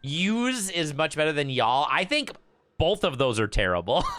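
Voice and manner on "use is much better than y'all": ordinary delivery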